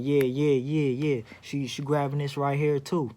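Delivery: loud, gleeful teasing yeah yeah; teasing hype. Retold or imitated. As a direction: egg her on, playfully loud. Teasing her at the counter.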